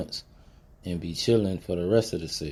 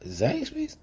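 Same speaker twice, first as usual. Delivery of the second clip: disbelieving jump up high on Zaxby's; stunned question, Zaxby's of all places; incredulous. Retold or imitated. Shocked at being turned down.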